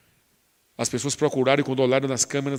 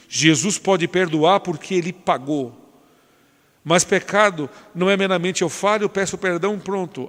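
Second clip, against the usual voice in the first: higher, louder preaching at full force; fiery preaching; 4 semitones higher, 5 dB louder than usual. Acted. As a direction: preach it with fire, climbing to the peak.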